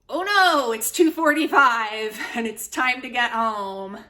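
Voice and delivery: high, loud oh no, laughing; mock alarm. Reviewer acting out the heroine's panic at being late, mock alarm.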